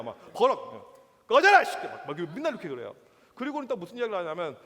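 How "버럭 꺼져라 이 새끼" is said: loud, high-pitched angry yell; angry father's outburst. Retold or imitated. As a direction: imitate a gruff dad blowing up. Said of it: "무조건 화내요".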